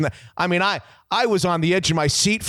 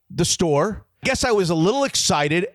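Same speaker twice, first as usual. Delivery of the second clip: ordinary talk, nothing marked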